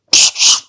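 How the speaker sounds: mouthed burst of Tommy gun fire; imitated gunfire. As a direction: make rapid machine-gun noises with the mouth. Mimes Tommy gun firing.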